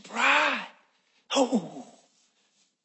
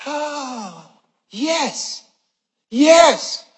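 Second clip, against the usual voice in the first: rising, higher eager yeses; 6 semitones higher, 4 dB louder, much slower than usual. Retold or imitated. Preacher retelling his eager reply to the Lord. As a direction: answer eagerly, building.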